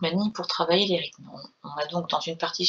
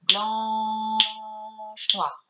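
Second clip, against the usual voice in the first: blanche held long over two beats, then noire; 3 semitones higher than usual. Shown as a demonstration. Teacher showing the long half note word. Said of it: "on fera durer le mot blanc".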